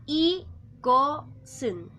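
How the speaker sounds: "igosun" in slow, separated syllables; model pronunciation. Shown as a demonstration. Korean teacher reads out the first example word, slow model pronunciation of "igosun". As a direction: pronounce "igosun" slowly, the s starting the last syllable. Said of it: "Esa es la pronunciación".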